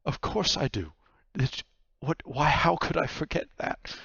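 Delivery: stumbling, indignant reassurance; incredulous Vaku. Acted. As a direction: answer as if the question is absurd. Vaku answers the nightmare.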